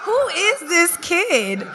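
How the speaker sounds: slow, high mock disbelief; a drawn-out, bemused who-is-this; incredulous. Sister watching her brother work the room, slow high disbelief. Amazed by brother's mazel tovs.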